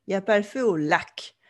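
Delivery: "lac" stressed as the last syllable; French rhythm with the weight on "lac"; French final stress. Shown as a demonstration. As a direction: land on "lac" at the end. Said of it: "c'est la proéminence sur la dernière syllabe".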